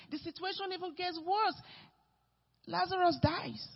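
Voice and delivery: loud, rising, fervent sermon delivery; preaching at full force. Acted. Preacher to the congregation, preaching at full force. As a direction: preach it hard, driving each line home.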